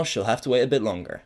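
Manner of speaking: usual speaking voice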